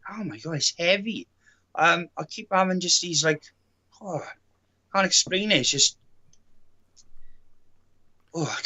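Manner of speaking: ordinary talk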